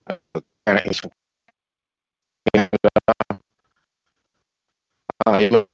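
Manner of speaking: cutting-out call audio; breaking up on a bad line; glitching audio. Real, not acted. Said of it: "Damn, you're cutting out again".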